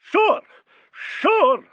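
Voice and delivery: high, loud outraged shrieks; incensed diva outburst; tirade. A narrator's reading. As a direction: fling the word back in disbelief. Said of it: "This produced a tirade".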